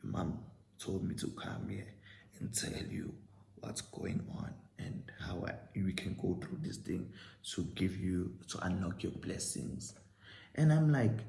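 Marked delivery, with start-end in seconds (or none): 0.0-9.9 hushed, secretive whisper; mock whisper. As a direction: keep it low like a secret ritual. Scammer whispering mid ritual.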